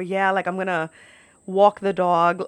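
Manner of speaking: demonstrating New York vowels; New York accent. Shown as a demonstration. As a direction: lean into New York "walk" and "dog". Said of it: "I don't really talk like that".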